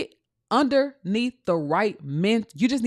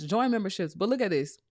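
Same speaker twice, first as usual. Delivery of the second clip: ordinary talk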